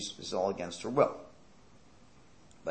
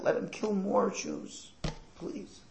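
mock tearful plea; 10 semitones higher, much slower than usual. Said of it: "crying and crying over her son".

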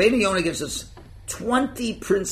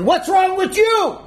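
high, loud indignant yelling; hammed-up outrage at the intruder; angry shout; 14 semitones higher, 4 dB louder than usual. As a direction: shout him out of the shul. Talkers yell at newcomer.

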